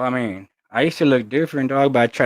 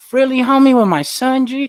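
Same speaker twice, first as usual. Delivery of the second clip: much higher and louder, incredulous protest; mock-indignant at being stopped with his son; retold outrage; 13 semitones higher, 5 dB louder than usual. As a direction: cry out in disbelief at the cop. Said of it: "And I'm like".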